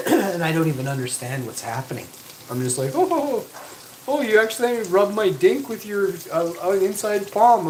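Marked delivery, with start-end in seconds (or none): 3.0-7.7 high, loud startled stammer; flustered surprise. Acted. Man reenacting his reaction to being touched, flustered. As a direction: act caught off guard, pitched way up.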